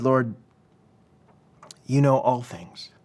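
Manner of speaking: understated hurt, with a long pause after "Lord". Retold or imitated. Peter answering Jesus the third time, subdued hurt. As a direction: retell a wounded, halting reply. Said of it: "But Peter was hurt".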